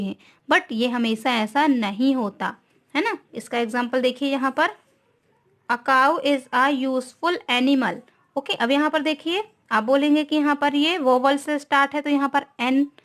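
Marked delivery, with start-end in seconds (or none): 5.7-8.0 reads the sentence very slowly, word by word; slow dictation. Shown as a demonstration. Teacher dictating an example sentence to students, very slow. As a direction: spell it out slowly for the class. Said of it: "teacher reads example sentence slowly".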